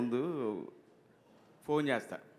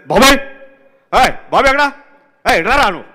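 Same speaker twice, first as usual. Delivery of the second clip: high, loud shouting for the assistant; director impression; 10 semitones higher, 23 dB louder than usual. Director storming in, yelling for Babai, loud and urgent. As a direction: yell for him in a hurry.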